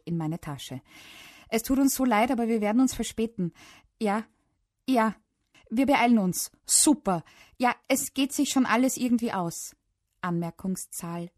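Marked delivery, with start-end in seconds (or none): 1.5-9.8 higher, louder phone voice; hurried phone apology. A narrator's reading. Mum telling camp leaders they will be late, hurried. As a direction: apologise brightly into the receiver.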